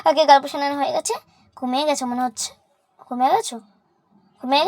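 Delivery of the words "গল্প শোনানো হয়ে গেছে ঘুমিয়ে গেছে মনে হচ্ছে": ordinary talk, nothing marked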